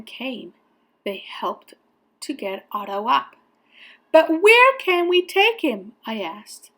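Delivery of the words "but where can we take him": high, loud, anxious asking; worried question. A narrator's reading. Boy asking firemen where to go, high worried voice. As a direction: ask urgently, at a loss.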